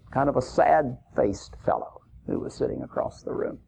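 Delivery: ordinary talk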